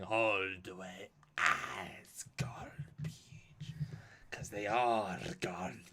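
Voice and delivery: slow, whispered villain line; Koloth. Pretend. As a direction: breathe it slowly like a menacing Klingon. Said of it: "it just cuts to Koloth saying".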